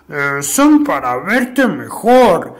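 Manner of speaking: slow, strained fake granny voice; wolf straining to sound like grandma; wolf faking grandma. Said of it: "imitando con mucho esfuerzo la voz de la abuelita".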